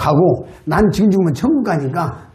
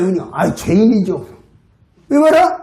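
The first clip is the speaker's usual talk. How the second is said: ordinary delivery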